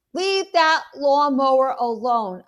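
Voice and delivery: loud, high order; yelling. Acted. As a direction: shout the warning at the thief. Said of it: "I'm yelling".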